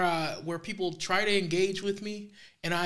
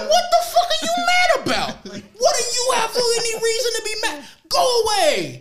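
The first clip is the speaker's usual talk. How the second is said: high, loud, laughing mock outrage; exasperated shout; 8 dB louder than usual. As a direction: yell it incredulously, playing up the outrage. Retold exasperated outburst at stranger.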